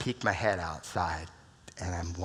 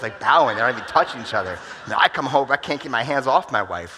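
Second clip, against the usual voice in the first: much louder, playful punchline; loud comic exclamation; 4 semitones higher, 12 dB louder than usual. Acted. Joking to the audience about hugging his wife, loud and playful. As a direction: belt out the joke to the room.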